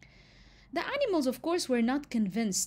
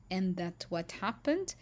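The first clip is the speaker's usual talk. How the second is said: usual speaking voice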